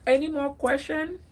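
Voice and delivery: usual speaking voice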